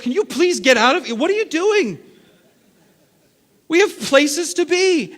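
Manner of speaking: high, loud, exasperated pleading; exasperated shopper. Retold or imitated. Stuck behind slow shoppers.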